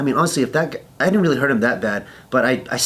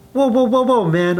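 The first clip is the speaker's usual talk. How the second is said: high, louder run of whoas; alarmed protest; 11 semitones higher, 4 dB louder than usual. Retold or imitated. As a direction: act out trying to halt someone. Protesting the insults, retold.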